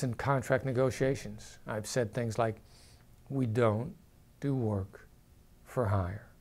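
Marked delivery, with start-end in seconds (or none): 3.3-6.3 slow, falling, softer phrases; downward inflection. Shown as a demonstration. Negotiator states a fixed contract term, downward inflection. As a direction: drop each phrase like a settled fact. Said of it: "Just like that".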